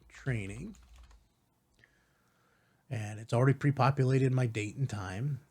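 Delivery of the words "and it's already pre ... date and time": usual speaking voice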